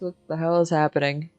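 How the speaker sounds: usual speaking voice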